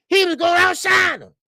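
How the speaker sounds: high, loud declaration; emphatic shout. Real, not acted. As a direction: hit it hard and high like the clincher. Building argument peaks in shout.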